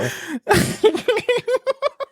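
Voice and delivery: real long high laugh; squeaky laugh. Real, not acted.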